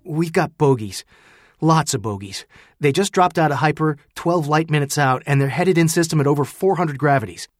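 officer under strain, pushing out words; strained, harsh. A narrator's reading. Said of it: "her normally calm contralto was strained and harsh".

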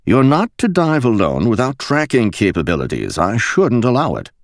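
prim English officer laying down rules; stiff, proper rebuke; captain forbids the solo dive, starchy English; starchy High Street voice. A narrator's reading. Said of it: "in his starchiest High Street voice".

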